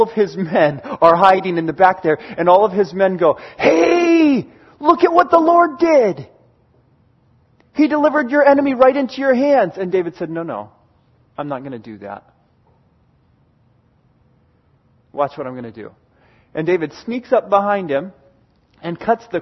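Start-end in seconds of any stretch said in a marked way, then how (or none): 3.6-9.8 higher-pitched excited urging, acting out a story. Men urging David excitedly in story. 10.3-15.9 low, hushed refusal in a retelling; quiet refusal. Retold or imitated. David quietly refuses his men.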